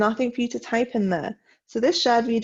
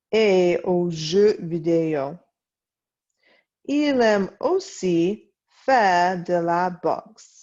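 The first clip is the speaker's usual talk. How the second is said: slow, clear French reading for students to follow; slow read-along; slower than usual. Shown as a demonstration. Teacher reading a French text aloud for her class, slow and clear. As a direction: read each word slowly so the class can read along.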